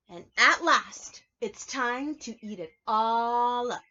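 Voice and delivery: slow, loud payoff line; big storybook climax. Acted. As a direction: announce the happy ending to the children.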